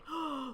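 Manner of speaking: sharp scandalized gasp; shocked gasp. Pretend. Narrator hearing the cruel insult, shocked gasp. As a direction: gasp in mock horror.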